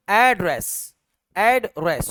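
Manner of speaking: Address as "AAD-ress"; American first-syllable stress. Shown as a demonstration. Teacher demonstrating American address, front-stressed. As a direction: stretch the start of address, drop the end. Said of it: "शुरू में खींचेगा आखिर में छोड़ देगा".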